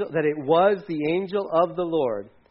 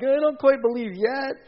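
doubting Gideon; 9 semitones higher than usual. Retold or imitated.

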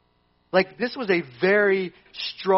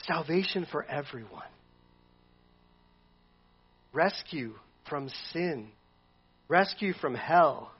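softer, slow, lower; solemn calm; 3 semitones lower, 7 dB quieter, slower than usual. Retold or imitated. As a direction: let each phrase sink in. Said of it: "And Jesus is thinking".

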